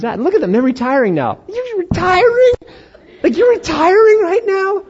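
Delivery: hammed-up incredulous squeal at retiring peers; mock disbelief.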